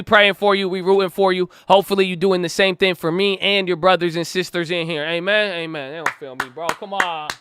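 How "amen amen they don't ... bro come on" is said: ordinary talk, nothing marked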